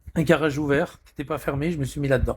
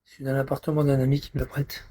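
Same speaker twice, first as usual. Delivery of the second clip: normal talking voice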